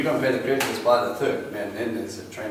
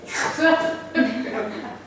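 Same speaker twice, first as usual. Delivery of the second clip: high, breathy burst of laughter; 12 semitones higher than usual. Real, not acted. Preacher laughing at his own money story, high laugh. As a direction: laugh at the joke.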